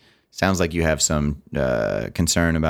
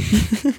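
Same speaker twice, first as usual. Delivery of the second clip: high laugh. Real, not acted. Laughing at joke.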